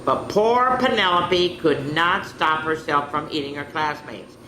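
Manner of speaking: plain reading voice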